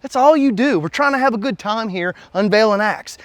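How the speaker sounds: high-pitched, put-upon complaint; exasperated shushing. Acted. Reviewer to heckler who mocked his impression, high exasperated protest.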